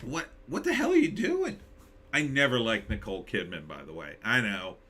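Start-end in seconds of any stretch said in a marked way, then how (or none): 0.0-1.6 high, disbelieving cry; incredulous. Real, not acted. Streamer aghast at the bug commercial, high incredulous cry. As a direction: react as if you can't believe it.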